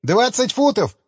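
high, panicked near-squeal; almost squeal the number; near shriek. A narrator's reading. Engineer calls out the distance, high panicked near-squeal. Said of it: "чуть ли не взвизгнул инженер".

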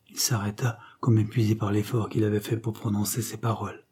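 ordinary delivery, nothing marked

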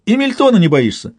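high, teasing question; cheerful high-pitched incredulity; merry amazement. A narrator's reading. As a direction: ask in high, amused astonishment. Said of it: "ещё веселее изумился Самсон".

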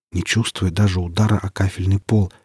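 ordinary delivery, nothing marked

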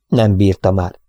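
plain reading voice

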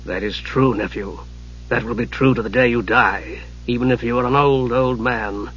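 low, soft, measured voice; dry old mage. A narrator's reading. Raistlin counsels his young nephew, low dry voice. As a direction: drop low and quiet, unhurried.

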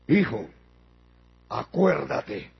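low, slow, grave voice; low-pitched, drawn-out reminder to a son; Abraham answering. Acted. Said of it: "pero Abraham le dijo".

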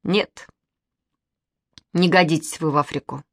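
mild disapproval; understated reproach; reproachful. A narrator's reading. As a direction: pass a let-down verdict. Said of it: "укоризненно сказал Костя".

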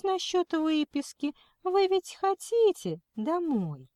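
high, slow scolding coo; tut-tut like at a toddler; kindergarten teacher voice. Acted. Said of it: "она засюсюкала".

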